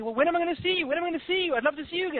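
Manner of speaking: very high, needy begging; hammed-up desperate neediness; acted clingy pleading. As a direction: plead not to be left, pitched right up. Pleading with departing bird.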